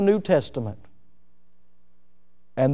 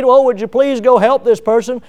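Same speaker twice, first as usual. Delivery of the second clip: pleading; 5 semitones higher, 4 dB louder than usual. Retold or imitated. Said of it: "beg, plead".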